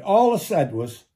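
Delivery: ordinary delivery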